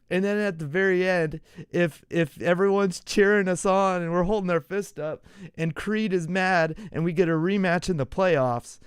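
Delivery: normal talking voice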